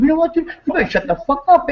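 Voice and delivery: high, fast threat; telling his brother to shut up; retold yell. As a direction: snap it out high and quick.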